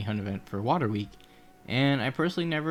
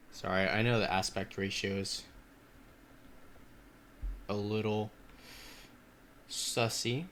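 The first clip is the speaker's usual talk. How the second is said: ordinary talk, nothing marked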